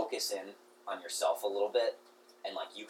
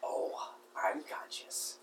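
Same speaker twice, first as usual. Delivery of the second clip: rat voice; 6 dB quieter than usual. Acted.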